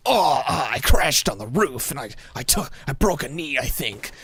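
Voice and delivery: hammed-up injured soldier, loud at first; mock crash-landing distress, imitating an over-committed role-player; all-in military character. Gamer imitating an open-mic player hurt on the roof, hammed-up military character. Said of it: "he just goes all in on the character".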